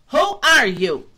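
loud, sharp goat demand; loud bold challenge; challenging cry. A narrator's reading. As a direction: goat shouting at a stranger. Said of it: "cried Ted Billy Goat".

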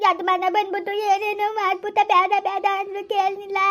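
weeping victim telling what happened; crying. Acted. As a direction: sob through the confession. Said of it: "ಅಳ್ಬೇಡ ಕಣವ".

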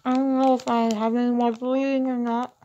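talking around gauze in the mouth. Real, not acted. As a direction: speak with gauze packed in your mouth. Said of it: "I got gauze in my mouth".

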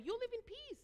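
high, scoffing imitation of an imperial official; mocking Roman ruler. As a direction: sneer it up high like a smug ruler. Preacher mocks Roman Empire's claim.

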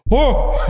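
high, loud alarmed "whoa"; mock-shocked dog howl; imitated distressed dog. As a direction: yelp it like a worried dog. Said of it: "she comes up to the door like".

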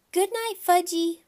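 put-on high, loud toddler echo; cheerful little kid signing off; little boy. A narrator's reading. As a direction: parrot it back like a small child. Toddler echoes his mother.

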